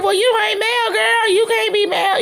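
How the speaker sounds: mock retort, pitched very high; high-pitched comeback. Retelling the comeback about not being Mel, high-pitched retort. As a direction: imitate her sassy comeback way up high.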